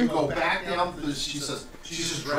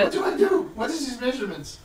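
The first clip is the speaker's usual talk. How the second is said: flustered tailor; 7 semitones higher than usual. Acted.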